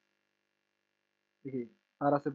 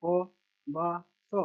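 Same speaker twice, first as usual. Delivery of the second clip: very slow, each syllable held apart; much slower than usual. Shown as a demonstration. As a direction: sound it out piece by piece for a learner. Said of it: "छोटा सा letter पढ़ लेना".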